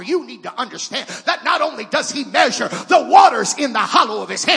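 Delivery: rising from firm to shouted preaching; building to full-force preaching. Acted. Preacher to congregation, climbing into a shout. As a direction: build it up till you are shouting the gospel.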